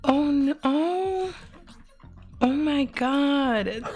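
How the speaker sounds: high, stunned gasping oh no; overwhelmed surprise. Real, not acted. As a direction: caught off guard by a kind gift. Streamer surprised by gift announcement.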